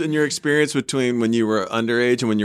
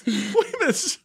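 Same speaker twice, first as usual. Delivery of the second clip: high, slow incredulous laugh-talk; can't believe what you just heard, laughing; shocked laughter at a wild detail; laughing disbelief; 12 semitones higher, slower than usual. Real, not acted. Shocked at strip search detail.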